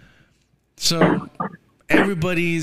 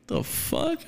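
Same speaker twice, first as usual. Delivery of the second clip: imitating shock; 5 dB quieter than usual. Retelling his shocked reaction.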